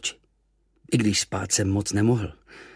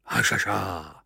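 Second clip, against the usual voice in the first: smiling hašaša; 2 semitones lower, slower than usual. Acted. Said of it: "usmál se a znělo to trochu jako hajaja".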